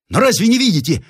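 high, urgent retort; exasperated high outburst; agitated interruption. A narrator's reading. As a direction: cut in sharply, pitch way up. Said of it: "перервал генерал".

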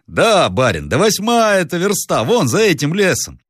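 high-pitched coachman pointing the way; бойкий ямщик. Acted. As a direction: answer the master eagerly, pitched high. (ямщик отвечает высоким голосом)

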